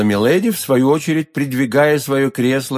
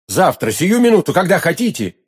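higher-pitched impatient offer; eager urgency; 6 semitones higher than usual. A narrator's reading. D'Artagnan offering milady instant revenge, eagerly.

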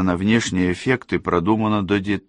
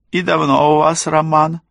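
high, louder feminine voice; pry in a high, raised voice; prying haughty woman; 11 semitones higher, 5 dB louder than usual. A narrator's reading. Rosalinda asks about the affair, high prying question.

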